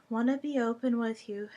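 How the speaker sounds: usual speaking voice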